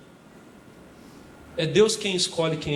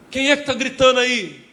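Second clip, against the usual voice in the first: startled shout; 6 semitones higher, 6 dB louder than usual. Retold or imitated. Saul woken, shouting back.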